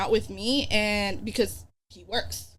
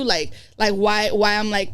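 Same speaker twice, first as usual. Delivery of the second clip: ordinary delivery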